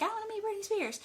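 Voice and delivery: high-pitched mock fangirl plea; mock eager fan. Hypothetical fan approaching a tour bus driver, mock eager.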